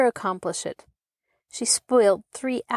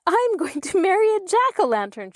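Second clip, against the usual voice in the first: much higher, giggly glee; gleeful; 11 semitones higher than usual. A narrator's reading. Maida back upstairs after her fright, gleeful and high. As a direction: burst it out still laughing. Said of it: "burst into a shriek of laughter".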